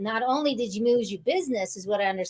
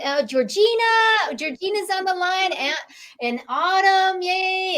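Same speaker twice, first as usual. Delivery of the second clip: excited greeting; 8 semitones higher, 3 dB louder than usual. Real, not acted. Host greeting callers excitedly.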